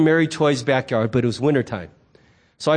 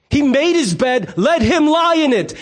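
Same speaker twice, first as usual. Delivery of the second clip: scornful judgment; 7 semitones higher than usual. Retold or imitated. Listeners condemning the prodigal son, mock indignant verdict. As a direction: pronounce sentence on the punk son.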